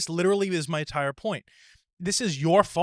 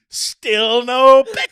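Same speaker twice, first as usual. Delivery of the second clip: high, very loud, stretched-out announcement; hammed-up triumphant shout; 9 semitones higher, 13 dB louder, much slower than usual. Acting out opening the burger with staff, mock game-show shout. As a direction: bellow it slowly like a game-show reveal.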